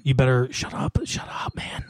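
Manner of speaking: hushed, urgent whisper; a cop's low whispered warning to keep quiet; retold whispered warning. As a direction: whisper it like a warning nobody else should hear. Cop warns him to shut up.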